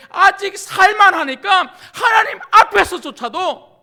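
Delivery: loud, high, impassioned rebuke; fervent preacher crying out to the congregation; full-force preaching. Real, not acted. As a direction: preach it hard, building to a shout.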